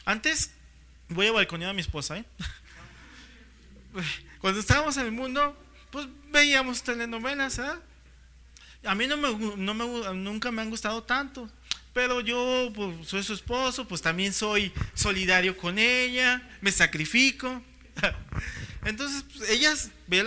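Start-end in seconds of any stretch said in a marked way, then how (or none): none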